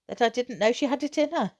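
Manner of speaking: ordinary delivery, nothing marked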